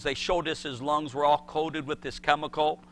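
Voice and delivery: normal talking voice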